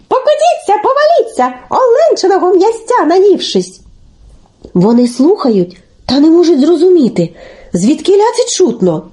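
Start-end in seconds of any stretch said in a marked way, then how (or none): 0.1-3.8 very high taunting little voice; calling out. A narrator's reading. Telesyk calls from the maple to the snakes, high and taunting. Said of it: "із явора вигукує".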